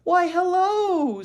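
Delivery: high, slow, sweet teacher greeting; teacher greets Ramona, high and sugary; pleasant. Acted. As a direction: greet the child brightly and slowly. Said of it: "said Miss Robbins pleasantly".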